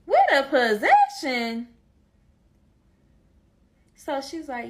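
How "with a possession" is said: slow, louder incredulous echo; disbelief. Retold or imitated. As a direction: repeat her words in shock. Shocked by eviction notice.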